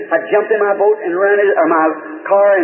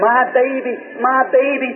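retold hollering; 6 semitones higher, slower than usual. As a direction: imitate a frantic mother calling for her baby, pitched up. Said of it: "she kept hollering".

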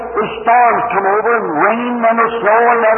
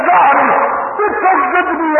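joyful cry; 6 semitones higher, slower than usual. Retold or imitated. Joyful outburst in the woods.

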